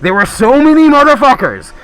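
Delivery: high, loud incredulous rant; exasperated shouted rant. Acted. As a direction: blow up at everyone who has no clue. Ranting at viewers ignorant of Daredevil.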